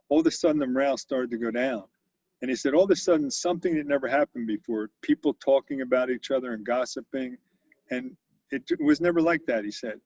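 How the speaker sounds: ordinary talk, nothing marked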